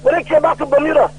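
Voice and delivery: ordinary delivery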